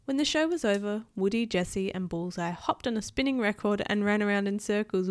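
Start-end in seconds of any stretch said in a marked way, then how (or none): none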